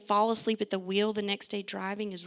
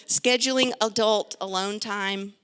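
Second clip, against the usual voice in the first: normal talking voice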